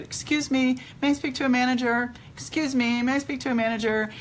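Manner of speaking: high, singsong request for attention; imitated customer hailing staff in a high voice; retold polite calling-out.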